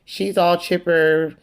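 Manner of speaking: usual speaking voice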